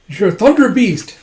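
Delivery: loud, high outburst; retold startled cry; acted exclamation. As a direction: exclaim it in astonishment. Soldier exclaims in recognition.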